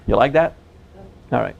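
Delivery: ordinary talk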